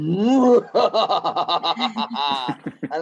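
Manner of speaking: loud, rising-then-tumbling evil cackle; big hammed-up villain laugh. Dad imitates Bandit with the magic xylophone, proper villain cackle. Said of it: "a proper villain cackle".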